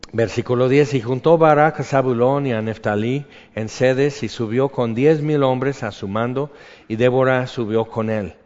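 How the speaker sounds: preacher declaiming a Bible passage; scripture reading. Acted. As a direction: read the verses out to the congregation. Reads scripture aloud.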